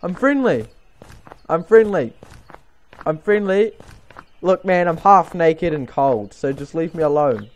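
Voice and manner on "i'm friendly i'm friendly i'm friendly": high, loud, frantic reassurance; panicked pleading. Acted. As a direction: beg them not to shoot. Pleading with in-game stranger.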